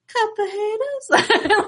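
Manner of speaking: high, slow catchphrase impression; Enzo. Coworkers' new catchphrase at work, imitated wrestler voice. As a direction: stretch the taunt out high, like a wrestler. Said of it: "I'm just in his voice".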